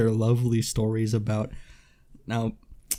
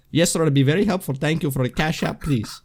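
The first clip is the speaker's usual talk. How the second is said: polite Indian scam caller; imitated Indian accent on a phone scammer; mock-helpful Indian call-center accent; 3 semitones higher than usual. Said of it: "an Indian person is calling me".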